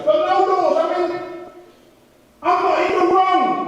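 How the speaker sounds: high, loud mock protest; protesting to God. Acted protest to the Lord.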